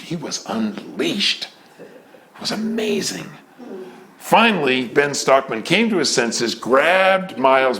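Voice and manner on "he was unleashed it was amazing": much softer, slower, breathy wonder; awed hush. Real, not acted. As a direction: marvel under your breath. Awed recollection, dropping to hush.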